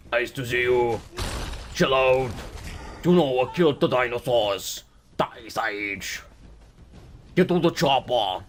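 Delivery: attempted action-hero impression; lightly voiced, badly faked Arnie catchphrases. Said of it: "I can't do an Arnie impre".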